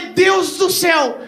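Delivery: grito agudo e lento de susto; espanto. Retold or imitated. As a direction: exclama apavorado. Pânico ao ver o piloto. (grito de espanto com riso)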